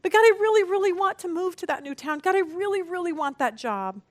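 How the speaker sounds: pleading with God. Retold or imitated. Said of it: "You say".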